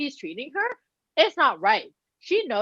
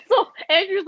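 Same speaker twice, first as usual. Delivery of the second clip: laughing; 9 semitones higher, 4 dB louder than usual. Real, not acted. Laughing while retelling.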